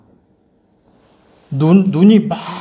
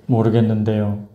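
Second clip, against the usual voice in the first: low, softer retold reply; meek answer; 6 semitones lower, 6 dB quieter than usual. Junior pastor answering his senior, quietly. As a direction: answer quietly, in a lowered pitch.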